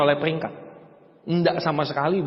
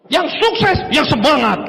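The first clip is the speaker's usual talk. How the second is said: high, loud mock hype shout; imitating a yelling MC; 6 semitones higher, 4 dB louder, slower than usual. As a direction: yell it like a hype MC.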